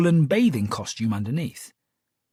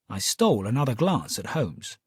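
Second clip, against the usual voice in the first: plain reading voice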